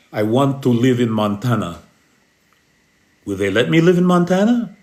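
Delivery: put-on a weak, half-hearted try at a Scottish accent; botched Scottish accent, quickly given up; attempted Scottish accent. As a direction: fumble through a Scottish movie-captain accent. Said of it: "I can't do the Scottish accent".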